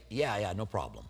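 soft, low easygoing reply; easy reassurance. Shown as a demonstration. Preacher showing how to accept an apology, soft and low. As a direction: wave it off kindly. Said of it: "you say".

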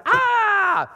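high, sharp mock scream of fright; startled scream. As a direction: shriek like a frightened disciple. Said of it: "others like".

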